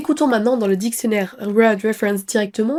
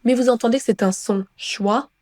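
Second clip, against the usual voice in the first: normal talking voice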